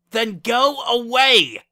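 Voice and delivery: loud, high, drawn-out furious scream. A narrator's reading. Lyra throwing Wendy out of her room, screaming. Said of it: "Lyra screamed".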